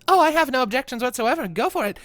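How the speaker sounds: lightly voiced breezy professor; Seraphina. Professor giving the student the go-ahead, lightly voiced and breezy. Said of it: "as Seraphina says".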